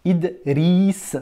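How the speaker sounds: Idriss split after Id, with rolled R in riss. Shown as a demonstration. Teacher lets pupil hear the R, saying Idriss in pieces with R rolled. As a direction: break Idriss after the d and roll the R. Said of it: "le R roulé".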